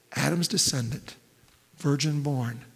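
ordinary talk

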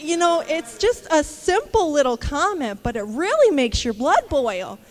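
ordinary talk

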